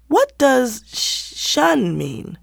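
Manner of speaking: put-on slow, high, hesitant child asking; halting question. A narrator's reading. Little Ruth haltingly asks her sister what shun means. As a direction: stumble over the unfamiliar word. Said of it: "Ruth asked haltingly".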